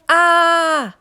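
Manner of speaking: high loud shriek of panic; panicked scream. A narrator's reading. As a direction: scream in terror at the top. CJ screams a third time.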